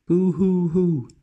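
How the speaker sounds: high, loud, drawn-out boo-hoo wail; child's crying. Acted.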